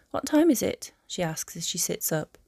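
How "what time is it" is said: higher, louder questioning woman's line; questioning heroine. A narrator's reading. She asks as she sits up, higher questioning voice. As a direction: voice her lifting into the question. Hermione waking, asks him.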